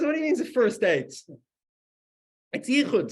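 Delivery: high, loud incredulous protest; hammed-up baffled outcry; acted indignation. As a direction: object loudly, imitating an outraged man. Acted quarrel, indignant man objects.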